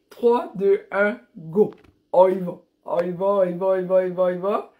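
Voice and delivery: loud count into a repeated rallying cry; excited countdown. Real, not acted. Live countdown cheering viewers on.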